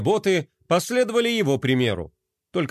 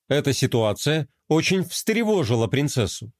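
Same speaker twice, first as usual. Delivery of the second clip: ordinary delivery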